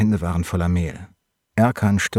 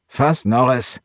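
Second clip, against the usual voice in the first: raised, sharp order to a dog; attack command; 6 semitones higher, 4 dB louder, slower than usual. Acted. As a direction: set the dog on him. Thug sets dog on victim. (scharfer, lauter Hundebefehl)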